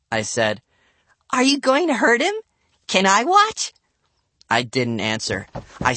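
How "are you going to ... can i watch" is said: high, eager little sister; excited child, pitched up; keen small-girl voice; eight-year-old girl. A narrator's reading. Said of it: "for an eight-year-old".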